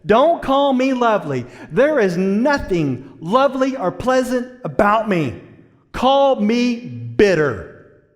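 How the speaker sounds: loud, high, bitter protest; bitter Naomi. Acted. Preacher as Naomi rejecting her name, loud and bitter. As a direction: spit out the refusal of the name. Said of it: "She's like".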